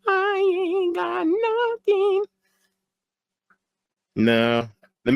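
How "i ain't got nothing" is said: very high, slow falsetto; high drawn-out falsetto. Pretend. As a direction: stretch every word out way up high. Same line replayed in two voices.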